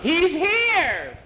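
high, loud excited cry; piercing yell of discovery; screaming. A narrator's reading. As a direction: shout it out in a high pitch. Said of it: "had heard Skeeter screaming".

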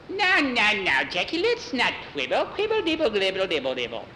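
high witch voice sliding down; witch babbling dismissively. Acted. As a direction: babble it away, starting high and falling. Witch brushes off Jackie's condition.

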